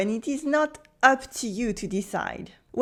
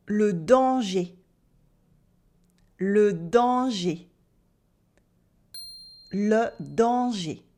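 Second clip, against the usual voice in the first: "Le danger" said slowly with a closed final é; slow teacher demo of "Le danger"; slow clear demo; 2 semitones lower, slower than usual. Shown as a demonstration. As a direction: stretch it out for the class. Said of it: "find out if I pronounce the sound E".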